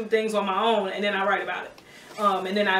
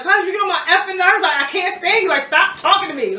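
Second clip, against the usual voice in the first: higher, louder exasperated rant; retold mad; 8 semitones higher, 5 dB louder than usual. Telling off her picking best friend, retold mad rant. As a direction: tell him off, reenacting old anger. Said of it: "Like, I was so mad".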